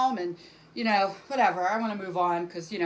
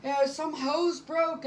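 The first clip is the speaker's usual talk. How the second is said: frantic cleaning lady; 7 semitones higher than usual. Retold or imitated. Cleaning lady panicking over flooded laundry room, high frantic voice. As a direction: squeal it like a panicking housekeeper. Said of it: "she's screaming".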